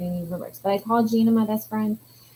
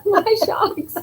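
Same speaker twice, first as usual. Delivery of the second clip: silly correction; 10 semitones higher, 6 dB louder than usual. Retold or imitated. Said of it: "I'm like".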